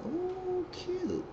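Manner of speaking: very high, soft, breathy coo; gushing squeal. Real, not acted. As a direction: coo it like you just saw a cute guy. Gushing over the cast.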